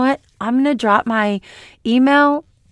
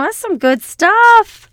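excited; 12 semitones higher, 6 dB louder, slower than usual. Real, not acted. Teasing next episode.

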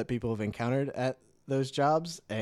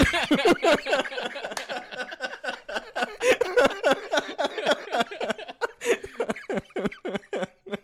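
long high-pitched cackling laugh; helpless peals of high laughter; long laughing fit. Real, not acted. Cracking up after welcoming listeners to the podcast, long high laughter.

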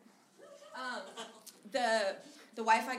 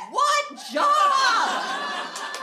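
shout; 14 dB louder than usual. Retold or imitated. Mocking shout back at roommate.

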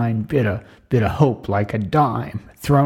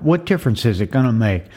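ordinary talk, nothing marked

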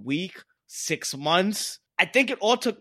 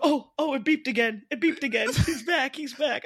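high, hushed excited exclamations; mock excited relief; 9 semitones higher than usual. Mocking the robot's return to life, high mock excitement. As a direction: play an amazed onlooker at a revival. Acting out the revival scene.

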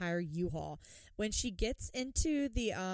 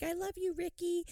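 lovesick Lacey; 10 semitones higher than usual. Retold or imitated. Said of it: "Lacey's like".

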